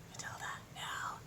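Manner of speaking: whispered scolding at the dog. Real, not acted. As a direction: hush the dog, breathy and voiceless. Scolding her dog mid-video.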